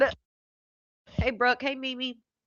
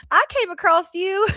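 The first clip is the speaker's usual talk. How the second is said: imitating her in a much higher voice; mock quote; 8 semitones higher, 4 dB louder than usual. Streamer retelling the princess's remark to viewers, high-pitched mock quote. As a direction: raise it high to mimic her.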